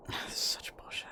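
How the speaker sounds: whispered curse under the breath; unvoiced muttered complaint; frustrated whisper. Real, not acted. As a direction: hiss it quietly in exasperation.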